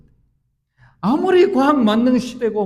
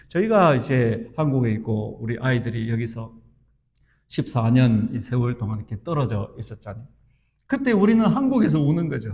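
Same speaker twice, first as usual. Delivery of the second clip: usual speaking voice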